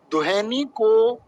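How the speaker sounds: slow, word-by-word dictation with held endings; dictating. Shown as a demonstration. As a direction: dictate it slowly for the class to write. Teacher dictates exam question while writing.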